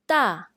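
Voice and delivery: "da" loud and falling sharply, fourth tone. Shown as a demonstration. Teacher models Mandarin fourth tone on da, falling. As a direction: slam "da" down like the hey. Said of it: "please listen carefully".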